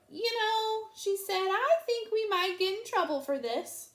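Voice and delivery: high, timid sheep voice. A narrator's reading. Sheep warns the others, high and timid. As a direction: worry about getting caught. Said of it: "Sheep cleared her throat".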